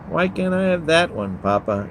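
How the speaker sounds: put-on high pleading child's voice; a daughter pleading with Papa; begging. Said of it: "Eve begs".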